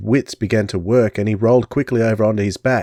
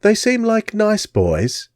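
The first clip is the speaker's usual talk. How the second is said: pitying Englishwoman; 11 semitones higher, slower than usual. Acted. Englishwoman pitying the boys, English accent. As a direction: speak kindly in an English accent. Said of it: "the Englishwoman said".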